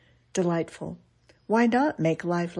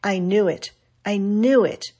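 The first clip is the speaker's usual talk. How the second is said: louder, rising frustration; bursting out in bitter vexation; exasperated outburst; 2 semitones higher, 6 dB louder than usual. Retold or imitated. Dying playwright cursing his fate, exasperated. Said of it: "his parting shot".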